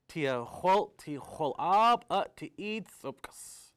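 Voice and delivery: ordinary delivery, nothing marked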